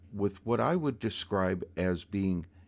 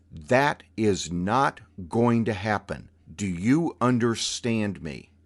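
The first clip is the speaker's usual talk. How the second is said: stern warning; 5 dB louder than usual. Retold or imitated. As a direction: spell out the rules like a hard judge. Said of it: "probably dropped a couple octaves".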